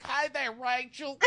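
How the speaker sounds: very high, slow, put-on Southern twang; fake Southern accent. Contestant greeting Rachel as she comes in, phony Southern accent. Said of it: "he's gonna talk like this".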